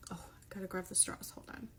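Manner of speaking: hushed unvoiced whisper. Real, not acted. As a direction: whisper it to the camera. Quiet aside while opening drink.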